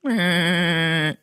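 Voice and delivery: a hint of the Family Guy dad; lightly voiced cartoon laugh; Peter Griffin voice. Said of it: "why are we doing Family Guy".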